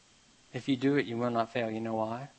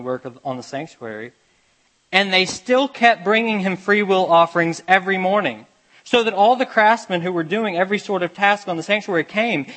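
raised, earnest reading of the passage; scripture reading; 4 semitones higher, 6 dB louder than usual. Acted. Preacher reading scripture to his church, raised and earnest. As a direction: read the verses out to the congregation.

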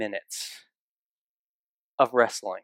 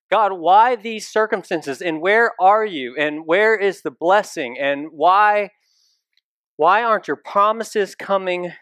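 pleading, raised voice demanding answers from God; anguished cry to God; 4 semitones higher, 3 dB louder than usual. Retold or imitated. Preacher voicing a believer crying out to God, anguished and raised. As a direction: call out to God in frustration.

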